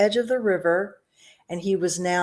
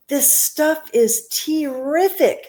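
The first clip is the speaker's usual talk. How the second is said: greedy delight; 8 semitones higher, 4 dB louder, much slower than usual. Acted. Greedy boy drinking chocolate.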